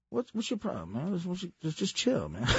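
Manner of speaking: soft muttered complaint; quiet annoyed. Retold or imitated. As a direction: mutter it under your breath. Bruce Smith annoyed.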